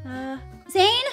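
ordinary talk, nothing marked

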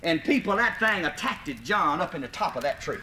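ordinary talk, nothing marked

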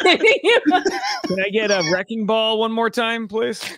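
high and slow, laughing as he asks; laughing request. Real, not acted. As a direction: ask through a laugh.

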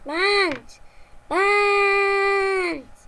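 loud drawn-out call across the house; shouting a name. Acted. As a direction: yell his name to wake him up. Shouting for friend to wake.

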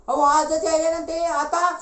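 high, loud, drawn-out exclamation; alarmed shout. Acted. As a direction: cry out the urgent news. Alarmed shout that guests come today.

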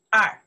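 二 said loud as one syllable; pronunciation of 二. Shown as a demonstration. Teacher contrasting readings of two, loud 二 demonstrated. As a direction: finish firmly on 二. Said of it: "the two have two pronoun".

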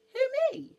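very high, loud squeak; astonished shriek of a question; amazed bee. Acted. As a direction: squeal in disbelief at being picked.

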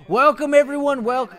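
loud, high host greeting; enthusiastic welcome. Real, not acted. Greeting crowd of customers.

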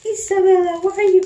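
loud, high-pitched mock dad with Honduran accent. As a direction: badly mimic a scolding dad's Honduran accent. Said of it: "Honduran accent".

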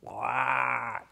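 soft, whispery far-off caw; distant crow call. Retold or imitated. Crow replying from two kilometers away, faint caw. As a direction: answer like a crow heard from afar. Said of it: "from about two kilometers away".

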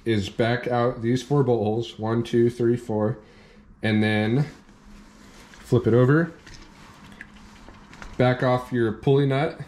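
ordinary talk, nothing marked

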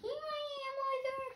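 very high calling chick voice; baby bird calling. Acted. Baby bird calling to the plane, high call. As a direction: call up to the sky like a tiny bird. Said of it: "my baby bird voice".

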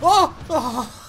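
high startled cry; startled yelp. Real, not acted. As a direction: jump at the surprise. Boss swaps with him.

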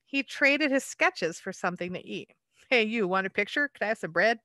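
lively picture-book read-aloud; story narration. A narrator's reading. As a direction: read it like a bedtime rhyme to children.

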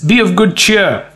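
high-pitched, breezy reassurance; mock-cheery greeting. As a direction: play it absurdly cheerful. Preacher mimics Jesus' breezy greeting.

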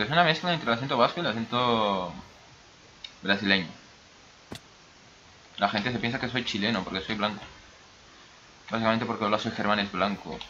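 usual speaking voice

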